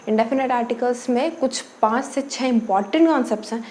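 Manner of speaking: normal talking voice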